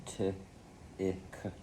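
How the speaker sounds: T, a gap, then Ick; finger spelling. Shown as a demonstration. Pupil finger spelling for teacher, sounds said apart. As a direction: split the word into T and Ick. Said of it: "Now finger spell".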